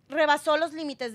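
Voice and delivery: ordinary talk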